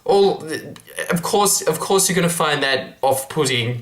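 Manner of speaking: ordinary delivery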